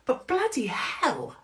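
normal talking voice

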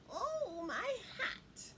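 plaintive, hushed cry over a loss; lamenting witch. Acted. Witch missing her lost hat, lamenting.

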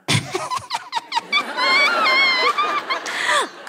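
very high held triumphant shriek of laughter. Acted. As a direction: cackle in victory. Triumphant cackle.

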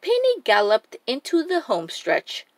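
plain reading voice

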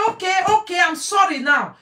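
high, loud insisting; heated shout. Real, not acted. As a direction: yell the quote at the audience. Heated quote at high volume.

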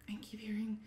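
whispering. Retold or imitated. Scared call to boyfriend.

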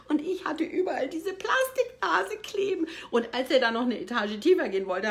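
ordinary talk

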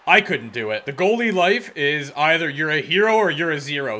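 normal talking voice

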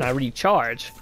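usual speaking voice

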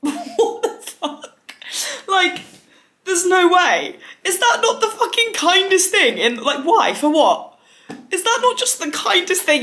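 squealing at a cousin's kind gift; stunned disbelief. Real, not acted. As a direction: cry out in overwhelmed disbelief.

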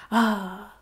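hammed-up sighing French lover; a drawn-out, cartoon French romantic; bad French accent. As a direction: put on a terrible French accent. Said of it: "I have the worst French accent".

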